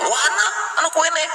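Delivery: high, fast exclaiming villager; mock local villager. Cirebon local quizzing a visitor about cakes, high and fast. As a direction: rattle off a puzzled question.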